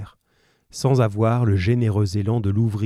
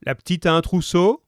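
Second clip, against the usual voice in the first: higher-pitched call from the back room; man calling from within; 8 semitones higher than usual. A narrator's reading. Husband shouts terms from the back, a contained call. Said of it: "La voix d'homme reprit".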